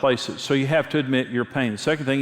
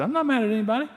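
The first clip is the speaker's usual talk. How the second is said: put-on much higher, defensive young man; young man brushing it off, higher; defensive denial; 12 semitones higher, much faster than usual. Young man's denial, voiced by preacher.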